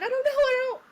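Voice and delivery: high, loud mock-frantic repetition; retold panic. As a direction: act out helpless flustered confusion. Retold panic amid the chaos.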